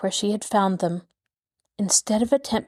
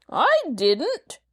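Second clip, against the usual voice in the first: sharp, high rough denial; retort; 8 semitones higher than usual. Acted. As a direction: robber snapping back at the accuser. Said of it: "the other retorted".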